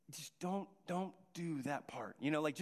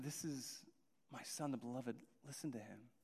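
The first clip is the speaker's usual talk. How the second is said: low and much softer, almost hushed; hushed divine voice; 7 semitones lower, 10 dB quieter than usual. Acted. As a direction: speak it low like a voice from a cloud. God's voice from cloud.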